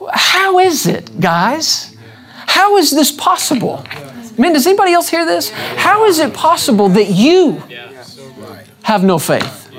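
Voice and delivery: high, amazed how is it; astonished. Shown as a demonstration. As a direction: sound truly baffled by their fear. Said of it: "hear the spirit in what he's saying".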